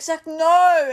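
loud, high refusal; high, loud objection; retold protest. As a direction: cry out a loud mock protest.

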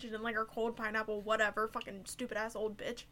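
normal talking voice

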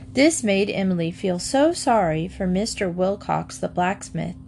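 usual speaking voice

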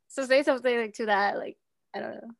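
ordinary talk, nothing marked

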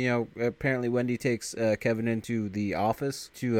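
ordinary delivery

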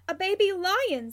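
high, loud, delighted astonishment; gasped amazement. A narrator's reading. Sirius sees a cub appear by the desk, gasping. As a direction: exclaim at a wondrous surprise. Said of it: "Sirius gasps as the cub appears".

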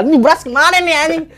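high-pitched mock-angry mother; scolding mom. Mother cursing her child over the rice, mock scolding. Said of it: "disumpahin mak gue".

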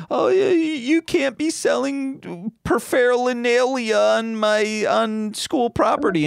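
high, stammering teacher voice; unconfident teacher. Retold or imitated. Teacher confronting student selling from his trunk, mock-timid. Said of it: "teacher who needs to have some self-confidence".

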